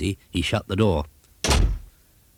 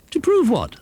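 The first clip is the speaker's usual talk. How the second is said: high, squeaky little broomstick voice; Broomstick's question; 4 dB louder than usual. Acted. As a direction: ask it in a much higher, puzzled voice. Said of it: "asked Broomstick".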